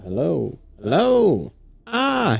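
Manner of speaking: usual speaking voice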